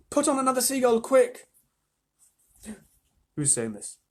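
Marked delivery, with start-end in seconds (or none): 0.1-1.5 high, raised calling voice; urgent order. A narrator's reading. Crew member wanting another bird, urgent call. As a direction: urge the crew to hurry.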